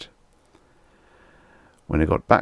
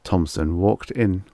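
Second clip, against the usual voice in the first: usual speaking voice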